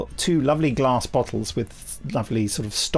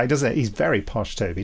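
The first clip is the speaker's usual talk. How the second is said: usual speaking voice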